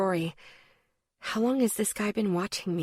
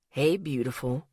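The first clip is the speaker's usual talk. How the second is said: much lower, smoky male greeting; lover's smoky voice; 6 semitones lower than usual. A narrator's reading. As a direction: roll it out low and smoky.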